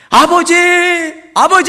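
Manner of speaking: loud, high anguished cry. Retold or imitated. Weeping believer crying out to God, loud. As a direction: cry out to the Father.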